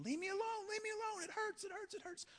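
agonized shrieks of a man in pain; screaming in pain. Retold or imitated. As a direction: scream it like the bones are popping. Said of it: "the guy starts screaming".

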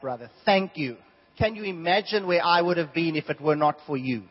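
loud, biting mock gratitude; sarcastic thanks. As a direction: lay the irony on thick. Sarcastic thanks to betrayer Peter.

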